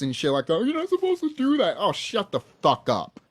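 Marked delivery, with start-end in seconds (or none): 0.6-1.8 high mocking voice of a scolding cop. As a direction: parrot the officer in a much higher whiny voice. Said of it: "government officials and your fucking cops".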